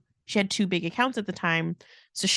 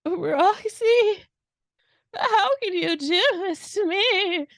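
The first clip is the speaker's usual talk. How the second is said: high, put-on sobbing plea; shaky cry voice; 11 semitones higher, slower than usual. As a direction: fake crying, pleading and high. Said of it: "forcing this, like, shaky cry voice".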